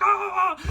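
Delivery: high, loud wordless holler in reply; imitated yell back. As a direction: holler back as if deafened by propellers. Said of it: "it was so loud you just couldn't hear".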